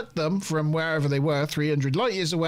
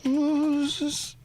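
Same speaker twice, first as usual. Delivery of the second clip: much higher mock android voice; host imitating Data, a higher mock voice; 6 semitones higher than usual. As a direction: play Data, measured and deadpan but high. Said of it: "Data's like".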